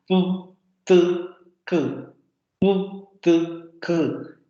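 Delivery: "Pu, tu, ku" popped in turn; walk the mouth back from lips to throat; "Pu", "tu", "ku" as distinct plosives; demoed stop series. Shown as a demonstration. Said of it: "si van pronunciando los fonemas".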